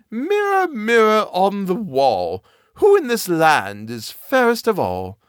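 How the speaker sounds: high, slow queen calling her mirror; commanding queen, drawn out. Acted.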